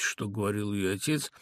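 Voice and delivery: ordinary delivery, nothing marked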